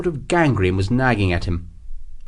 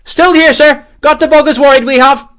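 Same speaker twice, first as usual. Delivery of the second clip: high, loud Irish call; cheerful shouted reply; 14 semitones higher, 8 dB louder than usual. A narrator's reading. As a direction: holler back, untroubled by the battle. Irish lieutenant calls back cheerfully.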